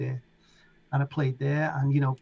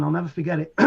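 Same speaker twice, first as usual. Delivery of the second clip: ordinary talk, nothing marked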